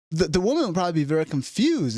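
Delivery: usual speaking voice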